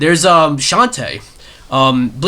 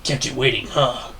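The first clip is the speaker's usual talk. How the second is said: Solid Snake impression; 5 dB quieter than usual. Shown as a demonstration. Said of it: "give me a Snake line".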